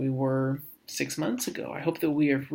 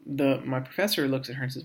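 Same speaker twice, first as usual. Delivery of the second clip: ordinary talk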